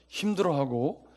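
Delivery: ordinary delivery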